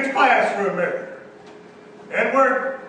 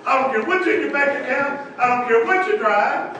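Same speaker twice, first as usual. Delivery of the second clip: usual speaking voice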